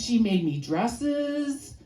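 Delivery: ordinary delivery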